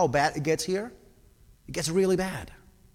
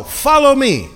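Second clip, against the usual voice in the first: higher and louder, urging them now; urgent call to go; 9 semitones higher, 10 dB louder than usual. Shown as a demonstration. Preacher acting out Jesus' summons to the crowd. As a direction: say it like you're leaving right now. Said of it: "you ready? Let's go".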